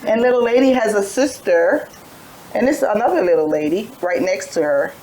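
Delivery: normal talking voice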